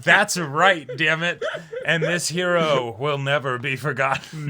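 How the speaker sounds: half-laughing hammed-up tribute; laughing mock vow. Fan laughing while vowing the hero lives on.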